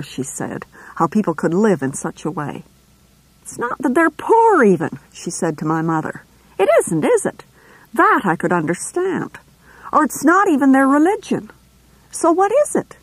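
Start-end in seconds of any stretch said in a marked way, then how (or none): 3.4-13.0 high, prim nurse voice, louder than the narration; a nurse sniffing at the neighbours, scandalised; disdainful nurse. A narrator's reading. Nurse complaining to my mother about the family, high and prim.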